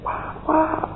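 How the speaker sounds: high-pitched mock cry of a boy; imitated child's yell. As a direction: call out high, like a boy running after someone. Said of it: "teriak-teriak kejar saya".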